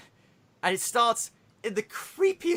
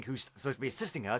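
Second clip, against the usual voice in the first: ordinary talk, nothing marked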